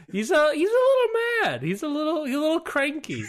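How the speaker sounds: very high, squeaky little voice; mock-cutesy understatement. Co-host joking that furious Joe is cranky, squeaky understatement. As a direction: play down the fury in a tiny high voice.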